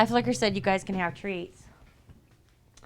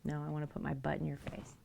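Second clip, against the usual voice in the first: whispered; 2 semitones lower, 8 dB quieter, faster than usual. Pretend. Voicing the cat's reply.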